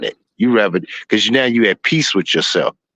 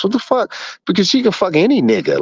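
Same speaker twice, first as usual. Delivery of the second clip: mock outrage at her; exasperated; 9 semitones higher than usual. Retold exasperation at baby mama.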